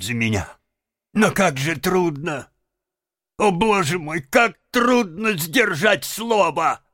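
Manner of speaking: high, plaintive character voice; sorrowful, theatrical Toad. Acted. Toad promising his friends to reform, tearfully melodramatic. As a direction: plead pitifully, building to an anguished high cry.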